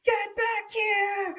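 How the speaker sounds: high, slow, full-throated mock scream; imitated screaming. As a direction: imitate a killer screaming out an order. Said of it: "then going into the full".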